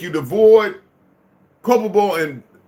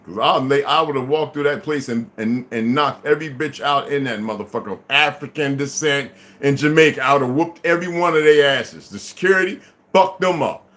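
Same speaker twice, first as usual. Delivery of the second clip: usual speaking voice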